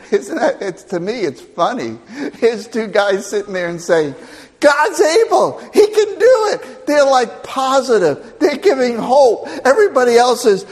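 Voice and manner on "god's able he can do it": high, bright mock voice with a laugh; cheerful believer. Preacher voicing the two hopeful spies, high and laughing. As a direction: chirp it full of hope.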